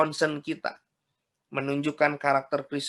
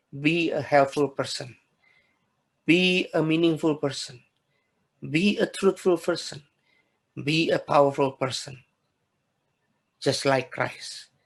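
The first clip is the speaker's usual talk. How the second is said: slow emphatic refrain; 2 semitones lower than usual. Acted. Refrain repeated to close sermon.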